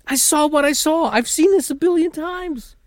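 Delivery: high-pitched, loud cop impression; loud, squeaky mock testimony; outraged cop. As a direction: protest in a strained high voice, hammed up.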